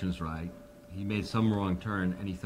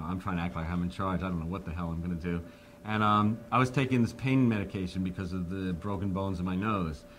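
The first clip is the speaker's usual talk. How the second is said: ordinary delivery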